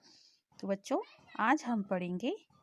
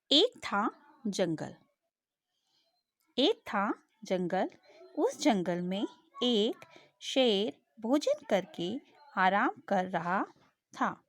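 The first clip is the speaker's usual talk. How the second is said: put-on slow storytelling voice for children; story narration; slower than usual. Teacher reading a story to young pupils, slow and clear. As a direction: read it slowly to the class like a storybook.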